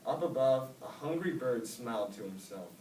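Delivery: usual speaking voice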